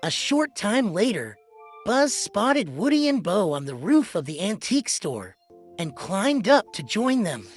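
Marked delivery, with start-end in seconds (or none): none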